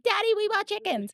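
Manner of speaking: high-pitched imitation of an excited child; squeaky mock kid spilling a secret; blurting little kid. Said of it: "my younger one was gonna blurt out".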